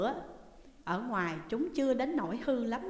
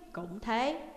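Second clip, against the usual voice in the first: ordinary delivery, nothing marked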